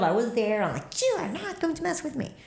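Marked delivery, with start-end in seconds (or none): 1.0-2.3 high, firm warning; telling off an unseen ghost; defiant warning to ghost. Retold or imitated. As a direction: stand your ground against the spirit. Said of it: "I had my shield".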